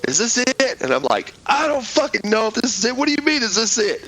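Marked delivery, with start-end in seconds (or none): 0.1-0.8 high, anxious pilot voice; imitating a confused pilot, pitched way up; pilot asking. Pilot turning round to ask where they landed, confused. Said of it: "looks at me and says". 1.4-4.0 high, incredulous mock retort; exasperated. Soldier snapping back at the pilot, incredulous.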